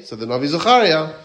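normal talking voice

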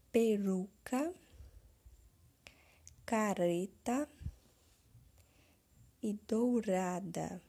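peruca, careta, dourada com o R fraco bem pronunciado; single R sounds. Shown as a demonstration. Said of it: "A pronúncia dela é diferenciada".

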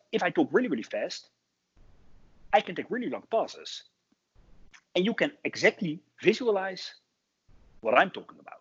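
quick bursts split by long pauses; fast with pauses. Shown as a demonstration. Speaking coach showing parents fast talk with pauses, quick bursts and long gaps. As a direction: rattle each phrase off, then leave a clear gap. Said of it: "So now I talked really, really fast, but between the sentences I added pauses".

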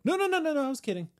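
high panicked backpedal as God; frantic God. Retold or imitated. God calling off Abraham in a high panic. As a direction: shriek no no no then calm down a bit. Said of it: "and God was like".

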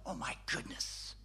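hushed, whispered astonishment; retold stunned whisper; awed realisation. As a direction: whisper it as the truth dawns. Replays his realisation on reading scripture.

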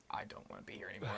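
breathy worn-out whisper, laughing; exhausted whisper. Retold or imitated. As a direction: whisper it, spent and giggling. Retelling exhaustion at workout.

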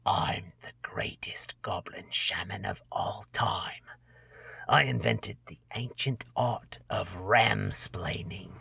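slow, soft hissed whisper; hissing whisper. Acted. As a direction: hiss it like a secret, slowly. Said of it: "whispers in a hissing voice".

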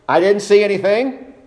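high, light imitation of a shrugging bystander; mock bystander excuse. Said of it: "and you just simply say".

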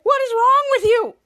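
high, loud acted scolding; outraged. Henry to Piper after the scare, outraged. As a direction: yell it like a freaked-out victim.

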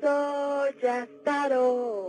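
very slow demonic growl of a name; possessed girl names her demon, slow and menacing. Acted. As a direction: drag each word out like a demon. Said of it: "hablaba con voz de hombre".

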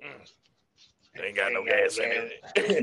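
very low, slow character voice; Sling Blade. Acted. Answering the lawnmower customer, slow deep character impression. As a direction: drop deep and drag the words out. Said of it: "The sling, and Sling Blade".

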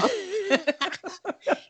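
long, high-pitched laugh; real high laugh, drawn out. Real, not acted. Cracks up at co-host's teasing.

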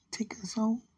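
whispered, barely voiced plea; soft whisper. Real, not acted. Whispering to her distant love at bedtime. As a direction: breathe it out as a whispered wish. Pleading to absent partner at night.

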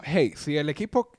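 ordinary talk

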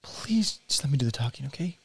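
low, soft aside; quiet, embarrassed request to stop; hushed plea. Acted. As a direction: plead under the breath. Pleading aside to partner.

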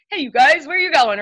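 half-hearted calling out from a window; mock shout. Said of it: "come out shouting".

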